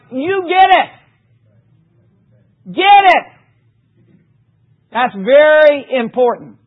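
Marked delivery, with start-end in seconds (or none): 0.1-3.3 higher, louder insistent You get it; emphatic repetition. Retold or imitated. Voicing the repeated verse to the church, insistent and high. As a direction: push it at them, higher and louder. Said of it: "it's like".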